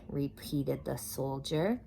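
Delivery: ordinary delivery, nothing marked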